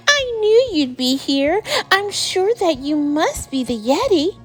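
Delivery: put-on high, eager boy's exclamation; excited shouting. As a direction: call it out high in delighted discovery. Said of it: "shouted George".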